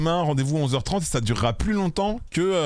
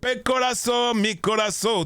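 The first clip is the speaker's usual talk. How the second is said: high, hammed-up fake Spanish; playful mock Spanish accent, much higher; 10 semitones higher, slower than usual. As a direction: toss off fake Spanish words with flair. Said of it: "je suis nul en espagnol".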